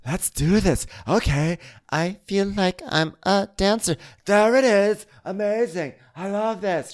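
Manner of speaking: high, gushing dance teacher; mock teacher. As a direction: cheer yourself on like a dancer. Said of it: "the teacher does, like, a demonstration".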